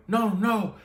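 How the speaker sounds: high, pained mock wail of no; a mock cry as the stock tanks; anguished no. Trader watching his stock crash, in high mock anguish.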